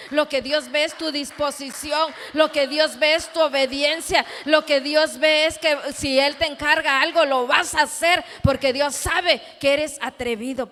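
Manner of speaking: loud, driving repeated phrases; fervent, forceful sermon crescendo; full-force preaching. Real, not acted. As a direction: hammer each clause home to the congregation.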